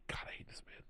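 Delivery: breathy whisper, nearly silent; whispered aside. Real, not acted. Reading a quote aloud, whispering a disgusted aside. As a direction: mutter it under your breath in disgust. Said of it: "aside whispered during the reading".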